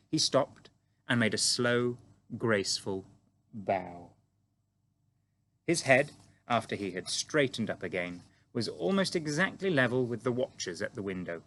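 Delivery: ordinary delivery, nothing marked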